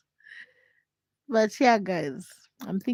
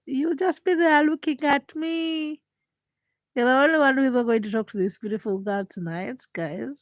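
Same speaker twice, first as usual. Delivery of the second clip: louder playful scolding; teasing complaint; 5 semitones higher, 4 dB louder than usual. Real, not acted. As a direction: tease the silent viewers. Teasing viewers for not talking.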